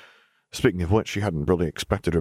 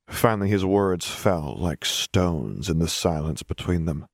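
plain reading voice

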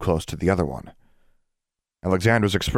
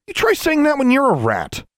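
very high, heated comeback; indignant retort; 4 dB louder than usual. A narrator's reading. Girl retorting to Journey after being a rat, indignant. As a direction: throw it back at him, high and indignant.

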